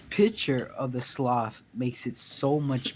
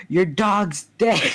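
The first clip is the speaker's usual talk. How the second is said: drawn-out mocking yell, higher; screaming; 7 semitones higher, 5 dB louder, slower than usual. Gamer screams at Danny over his dead dog, drawn-out mock yell. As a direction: scream it slowly at the grieving kid. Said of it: "this dude just starts screaming".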